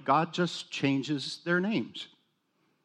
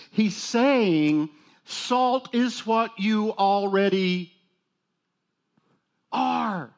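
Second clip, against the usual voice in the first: "salt" and "are" drawn out and leaned on; slow right down and lean on "salt", then lift "are"; slow emphatic stress; 4 semitones higher, 4 dB louder, much slower than usual. Shown as a demonstration. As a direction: spell it out slowly so nobody misses it. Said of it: "I want you to look closely at this".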